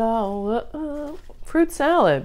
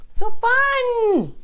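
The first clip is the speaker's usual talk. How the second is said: high, louder So fun; excited; 6 dB louder than usual. Real, not acted. Vlogger gushes about cherry tomatoes, excited and high. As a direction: burst out with glee.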